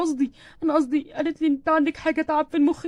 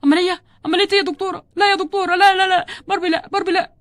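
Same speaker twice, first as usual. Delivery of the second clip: raised, louder cries of shock; panicked disbelief; 4 semitones higher, 4 dB louder than usual. Acted. Husband hears doctor say Barbie needs surgery, shocked and panicked. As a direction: plead against it, repeating in rising distress.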